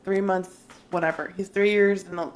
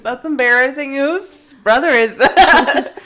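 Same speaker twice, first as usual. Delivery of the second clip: slow, louder, higher, laughing haughty remark; snooty disdain; 6 semitones higher, 11 dB louder, slower than usual. Retold or imitated. Sister recalling pretending her brother belongs to someone else, slow haughty disdain. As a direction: look down your nose at him. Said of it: "I'm like".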